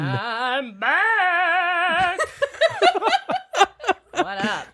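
drawn-out triumphant entrance; grand entrance. Acted. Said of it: "That was such an intro".

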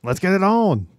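high referee voice; referee Mills Lane. Retold or imitated. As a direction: call the fight like a ref. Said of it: "Mills Lane was great".

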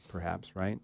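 ordinary talk

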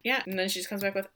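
usual speaking voice

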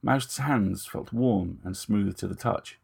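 ordinary delivery

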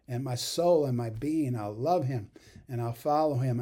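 loud, ringing vow of faith; loud declaration of faith. Acted. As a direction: proclaim it boldly to God.

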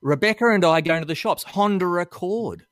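"Rebecca and" as "Rebeccar-and", "Honda Accord" as "Hondar-Accord"; exaggerated linking R after "Rebecca" and "Honda"; intrusive R. Shown as a demonstration. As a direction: push an R between "Honda" and "Accord". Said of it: "We put a R in there".

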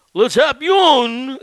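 high, loud, very slow drawled Le champion; Jim Ross. Retold or imitated. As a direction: ham up a Southern announcer mangling French, laughing through it. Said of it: "Jim Ross say".

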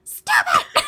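laughing high scold; high mock scold. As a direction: scold a friend in disbelief. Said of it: "Kristen's like".

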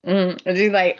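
normal talking voice

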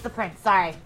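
sheepish quiet apology. Real, not acted. Apologizing for interrupting.

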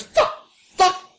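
high screaming rage, fast; furious tantrum. Acted. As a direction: throw a swearing fit. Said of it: "Retro Rat goes".

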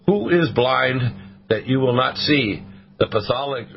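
ordinary delivery

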